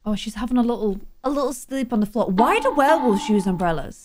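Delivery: ordinary talk, nothing marked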